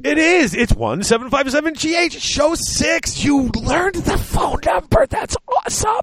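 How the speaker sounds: very high, squealing excitement; giddy high-pitched celebration; over-the-top excitement. Pretend. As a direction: gush in a squeaky high voice, hammed-up. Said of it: "I'm so excited".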